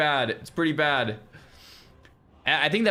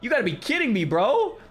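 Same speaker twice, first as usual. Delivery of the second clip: overjoyed surprise; 7 semitones higher than usual. Real, not acted. Receiving hundred gifted subs.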